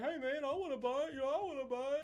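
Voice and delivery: high-pitched imitation of keen buyers; mocking eager fans. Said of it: "so many people's like".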